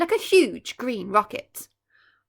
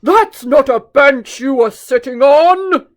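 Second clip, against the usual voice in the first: slow, much louder elephant bellow; 2 semitones higher, 15 dB louder than usual. Acted. Chunky warning the children, slow booming bellow. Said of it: "he bellowed".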